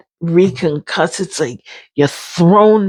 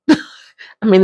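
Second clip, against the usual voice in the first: laughing; 2 semitones higher than usual. Real, not acted. Laughs at own story.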